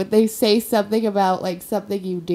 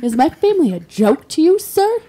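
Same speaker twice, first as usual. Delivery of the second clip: louder hammed-up affront, high on the last word; mock offended; 6 semitones higher, 4 dB louder than usual. Man confronting stranger over his family, hammed-up offence. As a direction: challenge him in mock outrage. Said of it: "I kinda got offended".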